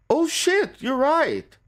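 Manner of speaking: very high, squeaky-pitched surprise; real astonished yelp, voice leaping up; startled realisation. Real, not acted. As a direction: blurt it out as it suddenly dawns on you. Suddenly spots detail in photo.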